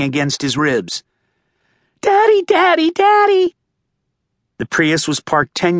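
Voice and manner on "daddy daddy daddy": put-on very high, loud child calling; small girl clinging to father; little girl. A narrator's reading. Girl bouncing in father's arms.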